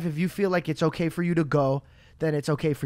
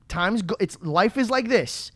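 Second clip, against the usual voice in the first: normal talking voice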